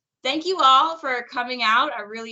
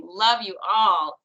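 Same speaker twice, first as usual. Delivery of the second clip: ordinary talk, nothing marked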